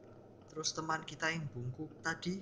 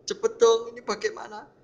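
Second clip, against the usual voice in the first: high, loud questions climbing higher; panicked helplessness; 12 semitones higher, 8 dB louder than usual. Real, not acted. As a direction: plead frantically for a way out. Stuck while trying to escape.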